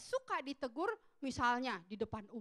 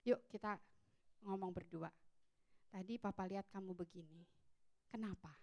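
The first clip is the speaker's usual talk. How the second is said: gentle father; 8 semitones lower, 8 dB quieter than usual. Retold or imitated. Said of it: "orang tuanya panggil".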